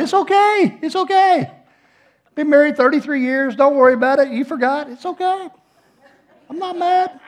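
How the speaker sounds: very high-pitched, squeaky reassurance; mock consoling. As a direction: comfort her in a comically high voice. Retold comforting of crying wife.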